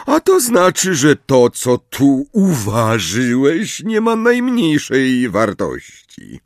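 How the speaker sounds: slow contemptuous professor; cold sneering teacher. Acted. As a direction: drag it out with scorn. Snape belittling Harry's potion.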